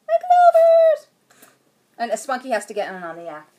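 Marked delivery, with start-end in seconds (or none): 0.1-1.1 very high, loud, drawn-out squeal; gushing pet talk. Real, not acted. Owner showing off her dog to viewers, high gushing squeal. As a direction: coo loudly over a pet, stretching the name.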